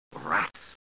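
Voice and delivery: a low, understated mock bellow; mild mock bellow. A narrator's reading. The Mookse dismissing the Gripes with a low mock bellow. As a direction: growl it low, only half a bellow. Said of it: "bellowed the mooks".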